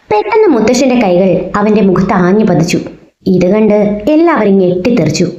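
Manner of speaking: plain reading voice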